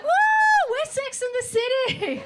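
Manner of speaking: high, loud, laughing whoop; mock excited cheer. Caught drinking strangers' cocktails, whooping giddily with laughter. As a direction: cheer like a tipsy girl's night out.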